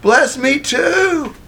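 high, drawn-out mock plea; stretched-out, high-pitched acted begging; upset pleading. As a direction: plead like a wronged son, slowly. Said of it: "Esau is so upset".

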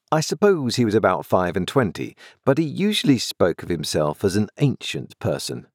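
usual speaking voice

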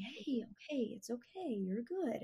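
the calm way to reassure the nervous dog. Shown as a demonstration. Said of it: "then I started to be calm".

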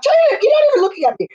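very high, quick mock complaint; imitated protest. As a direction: protest fast in a high put-on voice. Acting out an imagined retort.

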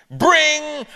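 high loud ring sound; doorbell ring. Acted. Narrator imitating the doorbell, high and loud.

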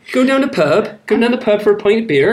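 hammed-up Somerset local ordering at the pub; Somerset accent. Host playing a Somerset pub-goer for his wife, in a broad Somerset accent. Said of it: "hear the accent".

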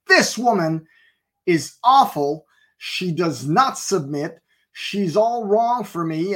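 acting out the fuming husband; retold angry complaint. Husband venting about his wife to the pastor, indignant.

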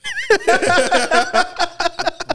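long high-pitched laughing fit; laughter. Real, not acted. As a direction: burst out in high, helpless laughter. Reacting to the zoo suggestion.

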